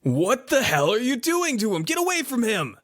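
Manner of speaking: high-pitched outraged yell. Acted. Neighbor to the monster over an unconscious kid, alarmed high yell. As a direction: cry it out high, as if in all caps. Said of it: "just, like, in all caps, just yells out".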